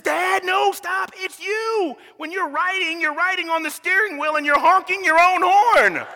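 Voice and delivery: put-on high, insistent kid voice; exasperated son. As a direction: stop Dad and explain it.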